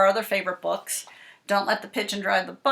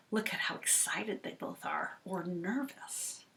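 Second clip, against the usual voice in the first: whispered, much softer; hushed; 14 dB quieter than usual. Acted. Teacher hushing kids over the picture, whispered. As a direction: lean in and whisper.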